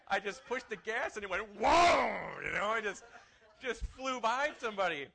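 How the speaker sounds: loud, high burst of exhilaration; whooping, shouted car story; excited retelling. Retold or imitated. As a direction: relive the thrill with a big shouted whoa.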